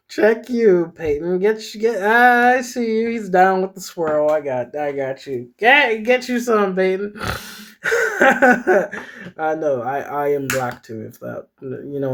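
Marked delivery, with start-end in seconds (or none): 0.1-7.1 high, loud, playful egging on; playful cheering-on; teasing hype. Real, not acted. Reader teasing the character.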